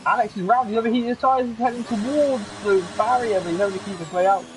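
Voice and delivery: high, rushing race commentary; excited call. Real, not acted. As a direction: call the near-crash with alarm. Car nearly hits the barrier.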